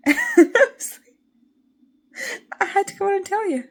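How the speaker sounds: giggling through the words; laughing. Real, not acted. Laughs at herself.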